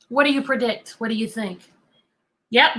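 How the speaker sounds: ordinary talk, nothing marked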